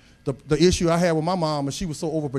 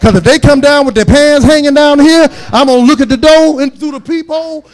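preaching at full force; 3 semitones higher, 10 dB louder than usual. Acted. Preacher laying down the law of his house, rising to a loud climax. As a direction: pound home the house rules like from the pulpit.

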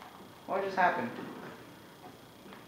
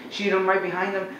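usual speaking voice

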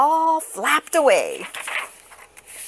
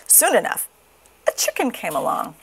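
usual speaking voice

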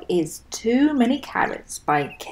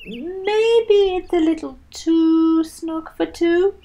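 higher, slow, doubtful Tortoise voice; gently hint it's too tight, slowly; suggesting Tortoise; 9 semitones higher, 4 dB louder than usual. Acted. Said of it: "suggested Tortoise".

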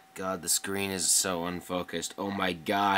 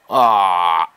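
a short loud cry of alarm; startled yell; 13 dB louder than usual. Real, not acted. Gamer hit by a plane on his tail, startled loud cry. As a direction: blurt a sudden loud ah. Sudden hit while dogfighting.

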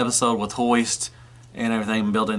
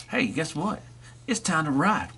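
soft, higher play voice; playful toy voice; 4 semitones higher, 5 dB quieter than usual. Pretend. Vlogger's toy tells another toy it's time to ride, soft play voice. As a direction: tease it like a kid with a secret.

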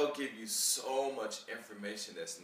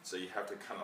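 ordinary delivery

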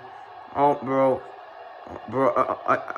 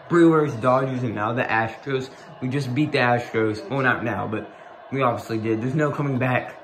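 ordinary delivery

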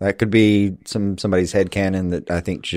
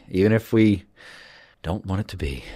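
ominous hint. Retold or imitated. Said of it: "every now and then you're like".

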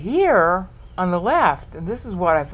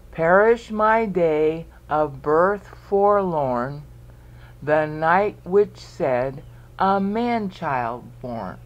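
very slow, deliberate verse reading; counting out syllables; much slower than usual. Shown as a demonstration. Teacher recites verse for students to count, slowly measured out. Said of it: "Count out the syllables".

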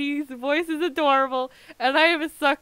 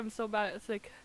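normal talking voice

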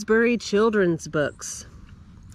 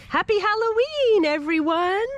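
cheerful greeting; 9 semitones higher, 3 dB louder than usual. Acted. Reading cheerful book line aloud.

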